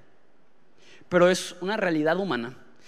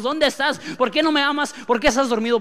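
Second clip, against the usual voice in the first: much higher, louder desperate questions; shouting at God; 9 semitones higher, 5 dB louder than usual. Retold or imitated. Disciples in the storm crying out to Jesus, high and loud. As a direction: hurl the questions at a sleeping God. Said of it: "le gritamos a Dios".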